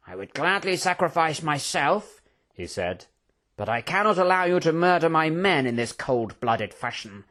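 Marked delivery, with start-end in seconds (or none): 0.1-2.2 controlled fury, mildly raised pitch; indignant general. A narrator's reading. General to the Prince, controlled indignation. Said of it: "The General glared furiously at the Prince". 3.6-7.3 restrained fury in a formal protest; indignant general. A narrator's reading. General defending his men to the Prince, indignant. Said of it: "glared furiously at the Prince".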